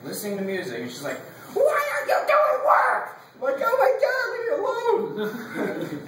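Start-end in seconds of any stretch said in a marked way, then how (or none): 1.5-3.2 very high, loud mock shriek; cranky teacher. Student imitating teacher scolding friends who are chatting, cranky shriek. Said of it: "she has the crankiest voice". 3.6-6.0 high mock wail, loud then drawn out; exasperated outburst. Student retorting to the nagging teacher, exasperated wail.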